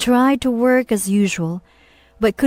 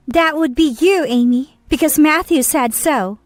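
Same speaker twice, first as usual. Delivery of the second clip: put-on higher, naive young woman; young woman's voice; 6 semitones higher than usual. A narrator's reading. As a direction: answer as if it is obvious. Mistress answers, higher.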